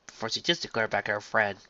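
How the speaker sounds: usual speaking voice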